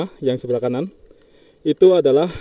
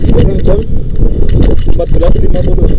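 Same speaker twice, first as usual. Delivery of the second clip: loud voice raised over strong wind; shouting over wind; 2 semitones higher, 12 dB louder, faster than usual. Real, not acted. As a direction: call out loudly against the gusts. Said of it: "karena berhubung anginnya besar banget".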